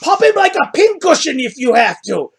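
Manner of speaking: high-pitched orc yelling orders; pushing, shoving orc. Acted. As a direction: urge the mob on in a high orc voice. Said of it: "hear him pushing and shoving".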